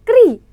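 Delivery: क्री said crisply with a long i, pitched high; clear kri. Shown as a demonstration. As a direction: pop out क्री like a teacher giving the answer. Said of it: "इसका उच्चारण क्या होगा?".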